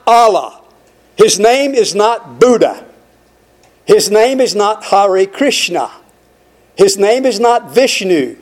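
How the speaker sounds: slow, insistent pulpit litany; preacher rejecting false gods one by one; preaching at full force. Acted. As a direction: hammer each name home.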